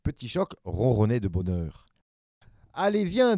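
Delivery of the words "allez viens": high, loud proud call. Acted. Big brother proudly calling the baby home. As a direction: call him along with pride. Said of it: "dit Abominable fièrement".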